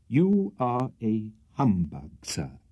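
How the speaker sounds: slow, distinct, each word weighed; brand him a humbug, deliberately; low emphatic. A narrator's reading. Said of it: "in a low but distinct and emphatic tone".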